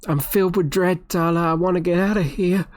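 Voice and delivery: halting, stammered plea with mild dread; pleading frightened patient. Acted. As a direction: beg a visitor for rescue. Patient begs to be rescued.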